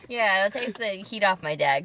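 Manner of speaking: ordinary talk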